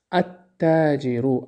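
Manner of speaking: التاجر said at-tajiru, lam silent, t doubled; التاجر without the lam, slow and careful; slow model reading. Shown as a demonstration. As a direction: read التاجر slowly as at-tajir. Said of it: "التاجر نمیگیم".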